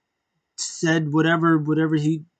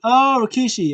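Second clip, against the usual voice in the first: surprised realization; 8 semitones higher, 5 dB louder than usual. Retold or imitated. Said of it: "I was like".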